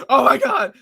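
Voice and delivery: high, loud oh my God; thrilled exclamation. Retold or imitated. Standing up from the couch at the reveal, a high loud gasp. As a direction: jump up overwhelmed by the moment. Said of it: "and I was like".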